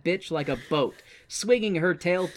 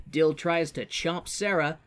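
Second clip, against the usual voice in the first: usual speaking voice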